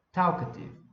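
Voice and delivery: teacher's demo of talkative, punching TALK; stress on first syllable. Shown as a demonstration. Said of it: "dar uma entonação maior pra essa sílaba".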